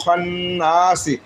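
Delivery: খন্নাসি slowly, doubled n held as a hum; held ghunna. Shown as a demonstration. Teacher shows the doubled-nun rule, humming the n in খন্নাসি. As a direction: linger on the nasal n in খন্নাসি. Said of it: "নুনের তাজদিদ থাকার কারণে এখানে এক আলিফ গুণনা করা ওয়াজিব".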